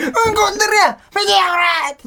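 high, loud delighted shout of 「うんこ」; excited shout. Retold or imitated. Showing how he yells whenever he spots dog poop, loud and high. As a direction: yell it out like a thrilled kid. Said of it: "俺毎回うんこ見て".

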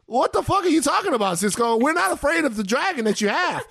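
high-pitched incredulous retort; playful, exasperated clap-back; mock outrage. Real, not acted. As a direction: challenge an absent singer in comic disbelief.

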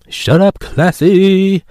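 high, loud cartoon kid voice; South Park voice. Muse telling Classy off, high cartoon voice. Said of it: "God, I love South Park".

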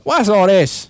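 loud, high mock English outburst; English accent. Co-host as English local catching an impostor, loud English accent. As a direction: burst out as an indignant Englishman. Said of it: "before they go".

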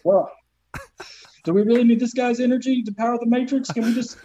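high-pitched, lightly mock pleading machine; reluctant machine. Matrix machines ask to skip a man, high pleading. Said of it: "they're just like".

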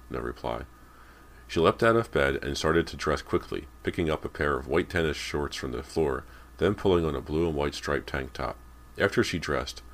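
ordinary delivery, nothing marked

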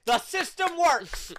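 loud, high, slow triumphant cheer; mock triumph. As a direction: proclaim it like a victory.

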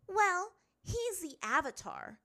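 high, loud, drawn-out argument; insistent. A narrator's reading. Azula arguing Aang is worthy, insistent. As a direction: press the point indignantly. Princess arguing her case.

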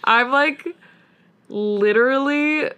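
real, slow, numb and drained; slow dazed reaction to a sad ending; stunned. Real, not acted. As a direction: let it sink in, dazed. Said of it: "I am numb".

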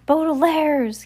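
high-pitched, drawn-out call; faint cry. A narrator's reading. Kit calls from atop the library raft, faintly crying out. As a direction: call the name out high, as from afar. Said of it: "came the faint cry of Kit".

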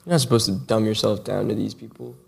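ordinary talk

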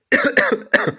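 a sharp loud cough; coughing fit. Real, not acted. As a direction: cough hard once. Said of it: "for that coughing".

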